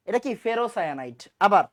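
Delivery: normal talking voice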